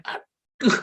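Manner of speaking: high, loud fed-up correction; retold burst of irritation; exasperated. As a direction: cut in, fed up with the fussing. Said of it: "Saya gusar jadinya".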